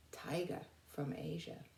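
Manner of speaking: soft whispered aside; just breathe it to the class. Real, not acted. Teacher whispering the caption to the class.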